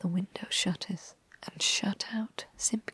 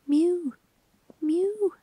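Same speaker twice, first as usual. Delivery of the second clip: high, louder plaintive mews; crying cat mew; 10 semitones higher, 6 dB louder than usual. Acted. Simpkin crying at the door to the mice, loud high mews. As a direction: cry out like a shut-out cat. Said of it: "cried Simpkin".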